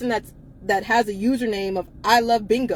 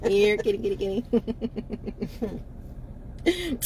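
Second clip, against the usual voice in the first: kitty call; 5 dB quieter than usual. Pretend. Calling a cat, joking.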